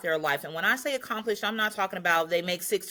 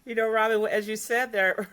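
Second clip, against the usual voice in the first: usual speaking voice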